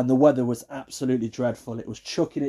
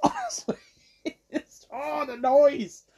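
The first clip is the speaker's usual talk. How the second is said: worked-up exasperation; 12 semitones higher, slower than usual. Real, not acted. Remembering baby's sucking noise, worked-up exasperation. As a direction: squeak with pent-up frustration. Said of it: "I'm getting angry now".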